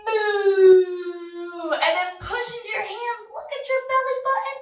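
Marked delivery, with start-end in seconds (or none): 0.1-1.7 loud, high, drawn-out moo; cow moo. Pretend. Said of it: "look on forward again for your cow".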